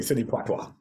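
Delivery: POITIER as "pwah-twah", softly; playful mispronunciation. Shown as a demonstration. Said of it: "Not even Sidney Poitier".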